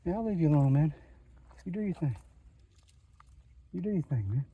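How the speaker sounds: letting the lizard be, hushed and gentle. Real, not acted. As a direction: murmur kindly as you back away. Talking to a lizard.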